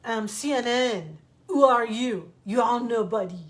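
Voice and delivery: put-on loud, arrogant French-accented boasting; mock French shout. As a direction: bark it like a pompous French cameraman. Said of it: "he'd say".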